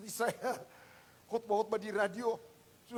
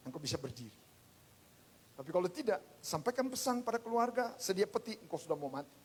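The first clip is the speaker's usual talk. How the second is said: ordinary talk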